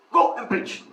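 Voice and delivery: imitated abrupt command; curt leader's order. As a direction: send them off with a short order.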